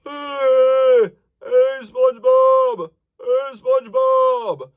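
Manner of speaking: high, loud, slow buzzy Hey, SpongeBob; focused buzzy call. Shown as a demonstration. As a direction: buzz it forward with focus. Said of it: "This one's a little more focused".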